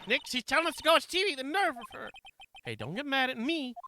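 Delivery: high, quick, loud indignant voice; indignant girl sidekick. Acted. Sidekick complains to Nick about being brushed off, indignant. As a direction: pitch it up and protest in a rush.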